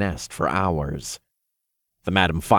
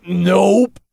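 gagging; 12 semitones higher, 8 dB louder than usual. A narrator's reading. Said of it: "Todd gagged".

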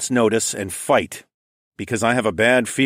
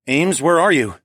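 louder, higher urgent call; 6 semitones higher, 5 dB louder than usual. A narrator's reading. Searching for his love, calling louder. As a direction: call out for her.

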